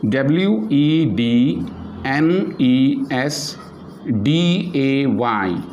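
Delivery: slow, letter by letter; spelling out letters. Shown as a demonstration. Teacher spelling Wednesday slowly for the class. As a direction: spell the word out one letter at a time. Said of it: "we pronounce it Wednesday".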